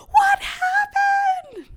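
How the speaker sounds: very high, slow, loud mock wail; horrified shriek. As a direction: shriek it like an appalled roommate, hammed-up. Roommate finds the bloody scene.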